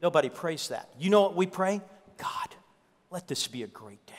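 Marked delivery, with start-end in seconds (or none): 2.2-4.1 very soft, half-whispered plea to God; hushed prayer. Acted. Preacher acting out what people pray to God, hushed. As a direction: murmur it low, like a private prayer.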